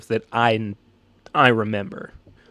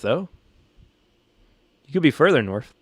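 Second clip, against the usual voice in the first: ordinary talk, nothing marked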